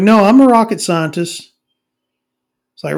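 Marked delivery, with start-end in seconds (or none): 0.0-1.5 higher and louder insisting; repeating the claim louder; insistent mock shout. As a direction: shout it back, offended. Acted quote repeated in argument.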